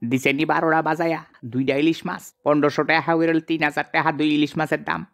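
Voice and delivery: ordinary talk, nothing marked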